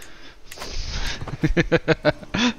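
high breathy cartoon laugh. Real, not acted. Laughs at game event.

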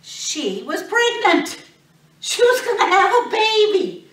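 high, loud, laughing announcement; excited reveal. Real, not acted. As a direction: announce the big news with delight.

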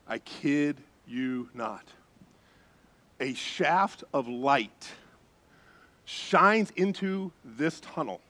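slow, hushed, deliberate storytelling; slow awestruck build; slow awed telling. Real, not acted. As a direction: let each phrase land slowly. Slow dramatic reveal.

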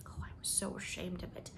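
hushed, ashamed confession; ashamed whisper. Acted. Leslie confessing her shame to Anne, whispered. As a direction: breathe it out in shame.

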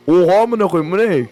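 shocked outburst. Real, not acted. Gamer startled by something in the stall, shocked. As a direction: blurt it out in sudden shock. Sudden scare in game.

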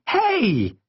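high, protesting exclamation; indignant cry. A narrator's reading. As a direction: cry out in protest at being hit. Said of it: "I cried".

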